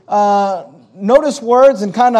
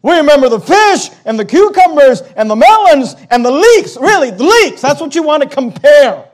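higher, louder, hammed-up list of grievances; mocking disbelief; 4 semitones higher, 6 dB louder than usual. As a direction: recite the foods, then scoff at them.

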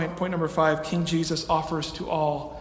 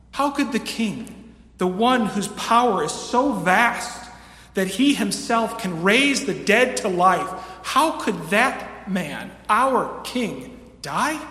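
raised pitch, slow emphatic disbelief; incredulous questioning; 4 semitones higher, 4 dB louder, slower than usual. Acted. Preacher voices a crowd unable to believe their king dies, incredulous. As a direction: draw out each phrase in loud, high incredulity.

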